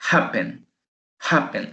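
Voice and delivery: happen, voice force on HAP; stress on first syllable. Shown as a demonstration. Teacher shows where the force falls, stressed HAP in happen. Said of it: "Ahí se escucha la, la fuerza de voz".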